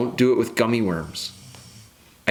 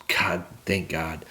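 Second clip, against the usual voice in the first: whispered, slow thanks under the breath; relieved whisper; 5 semitones lower, 5 dB quieter, slower than usual. Real, not acted. Father relieved about diapers, whispering thanks.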